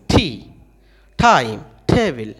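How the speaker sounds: টি, টাইম, টেবিল with a puff after t; English "th-ee" style aspirated t in টি. Shown as a demonstration. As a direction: breathe out hard on each t of টি, টাইম, টেবিল. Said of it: "টি এর উচ্চারণ কিন্তু এইরকম".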